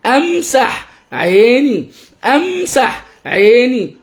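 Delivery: أمسح عيني word by word, vowels stretched; drawn-out, sing-song أمسح عيني for a child to copy; slow modelling for repetition. Shown as a demonstration. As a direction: teach أمسح عيني slowly with a high lilt. Models phrase for child to repeat.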